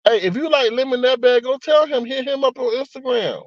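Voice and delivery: high, teasing jab. Real, not acted. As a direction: poke fun at the viewer. Teasing chat about a viewer.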